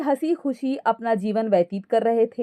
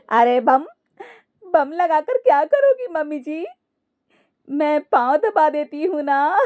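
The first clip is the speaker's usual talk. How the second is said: high cackles bursting round cheerful words; uncontrolled acted laughing; 6 semitones higher than usual. Daughter-in-law offering to press mother-in-law's feet, laughing helplessly. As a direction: act a daughter-in-law who cannot stop laughing. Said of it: "हंसने वाली दवा खा लेती है".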